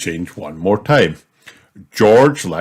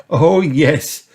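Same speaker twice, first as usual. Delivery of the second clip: lightly amused granny, pitched up; laughing; 8 semitones higher, 4 dB louder, slower than usual. A narrator's reading. Granny reassuring Peppa, mildly laughing. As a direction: reassure her with a touch of laughter.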